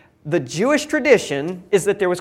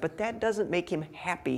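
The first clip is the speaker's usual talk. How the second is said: ordinary talk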